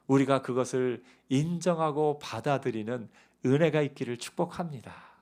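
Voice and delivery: ordinary delivery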